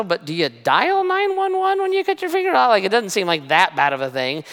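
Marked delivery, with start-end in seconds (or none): 0.0-2.7 incredulous inner question. Retold or imitated. Inner panic over a cut finger.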